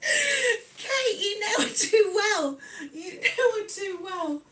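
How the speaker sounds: high-pitched, through real laughter; laughing. Real, not acted. Streamer tickled by Kate's remark about the cat, laughing. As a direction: crack up while saying it.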